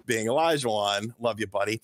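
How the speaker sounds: ordinary delivery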